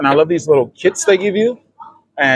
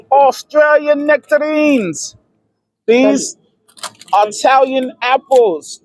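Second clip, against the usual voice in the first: high, slow showcase calls; excited announcing; 9 semitones higher, slower than usual. Real, not acted. Vlogger presenting fruit to viewers, high and drawn out. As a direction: sing out each fruit like a showpiece.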